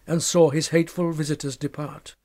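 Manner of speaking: usual speaking voice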